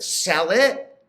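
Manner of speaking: usual speaking voice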